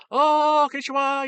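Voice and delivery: very high, loud mock Japanese greeting; hammed-up Japanese chef's cry; heavy Japanese accent. Hibachi chef welcoming diners, loud mock Japanese accent. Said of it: "you know, a very heavy accent".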